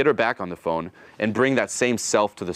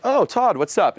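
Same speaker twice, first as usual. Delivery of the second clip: pleased recognition; 11 semitones higher, 3 dB louder, slower than usual. Retold or imitated. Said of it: "some would be like".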